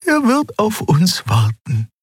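high, hopeful alien woman; Xea's voice. A narrator's reading. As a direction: lift into a high, bright reassuring voice. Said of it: "sagte sie".

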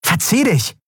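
high, sharp order to go away; angry dismissal. A narrator's reading. Endriel telling the dealer to get lost, sharp and angry. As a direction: drive him off.

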